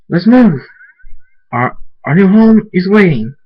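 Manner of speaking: high, loud call to move; rally the group to set off; rallying shout. Acted. Leader rallies group to move.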